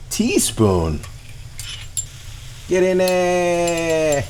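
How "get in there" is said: slow, stretched, higher coaxing; drawn-out cheer. Acted. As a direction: coax the food into the pan slowly. Tossing food into pan.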